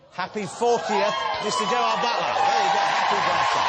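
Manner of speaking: host cheering the guest's birthday; birthday announcement. Acted. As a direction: trumpet the birthday to the room.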